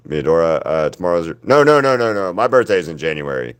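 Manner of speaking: ordinary talk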